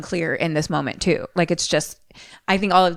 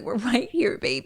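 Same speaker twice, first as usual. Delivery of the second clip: softer, matter-of-fact mom voice; speaker's own mom saying it, softer and plainer; my mom's version; 5 semitones higher, 5 dB quieter than usual. Retold or imitated. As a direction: say it lightly, like a real mom would. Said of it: "Mommy's just like, or my mom is just like".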